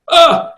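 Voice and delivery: high-pitched "ugh", mildly put on; retold groan of dismay. Retold reaction to an insult.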